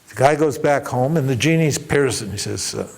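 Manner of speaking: ordinary delivery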